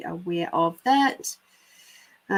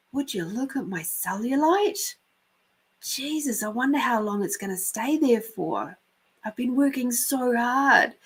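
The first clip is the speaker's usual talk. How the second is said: another different voice; 4 semitones higher than usual. Retold or imitated. Storyteller voicing someone outside a gym, lightly put-on complaint. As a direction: imitate an overheard gym-goer, lightly voiced. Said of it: "I heard another different voice going".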